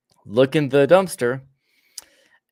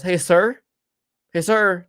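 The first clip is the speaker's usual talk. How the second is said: sheriff hailing from his car, pitched higher; calling out; 5 semitones higher, 5 dB louder than usual. Retold or imitated. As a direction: call over to a stranger, slightly raised voice. Sheriff calls from car window.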